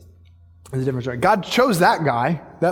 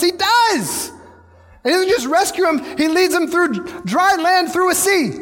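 very high, emphatic proclamation; fervent preaching; 12 semitones higher than usual. Real, not acted. Preacher declaring God's rescue through the sea, fervent. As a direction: drive each phrase home at a high pitch.

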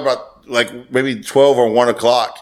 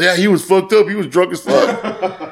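laughing buddy voice; 5 semitones higher, slower than usual. Retold or imitated.

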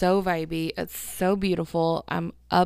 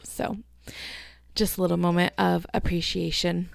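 ordinary talk, nothing marked

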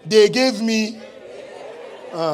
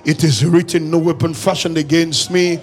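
preaching at full force; 6 semitones higher, 10 dB louder than usual. Acted.

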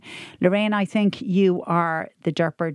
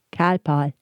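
put-on deadpan toddler; 3 semitones lower than usual. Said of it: "And my daughter said".

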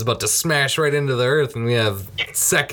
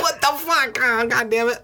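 much higher, hammed-up frustration; mock exasperated outburst; 13 semitones higher than usual. Retelling the husband stuck with his family, high mock exasperation. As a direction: squeal the curse, fed up. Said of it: "He's like".

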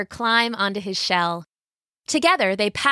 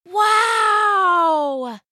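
high, loud cry of wonder; amazed rabbit gasp; 11 semitones higher, 4 dB louder than usual. A narrator's reading. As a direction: gasp out in awe. Rabbit sees Dragon King.

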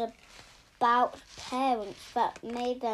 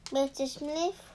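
plain reading voice

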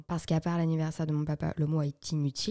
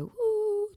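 high, drawn-out party "wooh"; mock party-mood whoop; festive whoop. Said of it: "tu vois, genre, festif".

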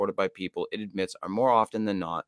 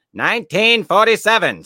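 "Nineteen forty-seven" belted much higher and louder; emphatic exclaim; 11 semitones higher, 11 dB louder than usual. Real, not acted. Repeats the year emphatically.

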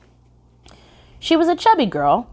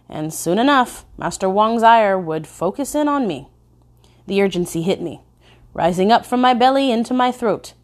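plain reading voice

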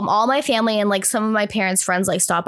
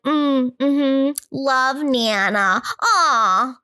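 kid's put-on grown-up cooing over a card; slow, higher, appreciative hum and aw; mimicking grown-ups; 4 semitones higher, much slower than usual. Woman imitating herself reading Nana's card, mock-grown-up cooing. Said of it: "I was, like, trying to be".

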